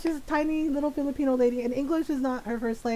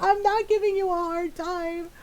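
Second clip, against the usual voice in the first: high-pitched comic exasperation, building louder; exasperated; 7 semitones higher, 5 dB louder than usual. Retold or imitated. Grown child arguing with mom, mock exasperated. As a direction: plead in mock frustration, stretching the last words.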